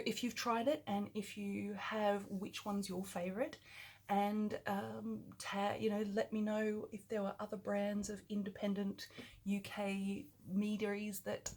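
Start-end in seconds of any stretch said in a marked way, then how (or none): none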